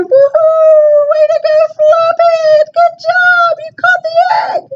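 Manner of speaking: high, loud, fast cheering; big cheer. A narrator's reading. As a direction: cheer Floppet on wildly. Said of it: "The friends all gave a great big cheer".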